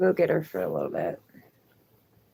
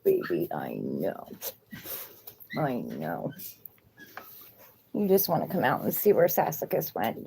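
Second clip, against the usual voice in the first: soothing pet talk; 3 semitones lower, 6 dB quieter than usual. Real, not acted.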